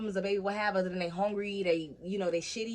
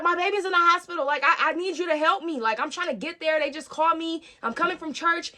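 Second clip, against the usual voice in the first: high, loud re-enacted plea; frantic pleading; 8 semitones higher, 6 dB louder than usual. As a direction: act out begging an officer for help, urgently.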